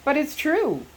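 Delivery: high, urgent blurt. A narrator's reading. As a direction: burst out with it, pitched high. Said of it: "Jay blurted".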